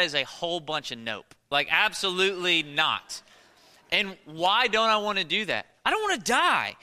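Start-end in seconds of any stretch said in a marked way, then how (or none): 5.9-6.7 high-pitched comic refusal; emphatic protest. Acted. Preacher explains refusing the pole jump, emphatic protest. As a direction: protest it, hammed-up and higher. Answers why he won't jump.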